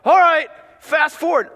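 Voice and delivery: a mom barking at the remote; mom calling out. Retold or imitated. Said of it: "mom would be like".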